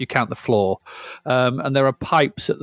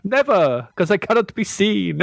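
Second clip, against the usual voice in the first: vampire voice; 8 semitones higher than usual. Pretend. Answers in the vampire bit.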